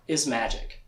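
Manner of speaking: ordinary talk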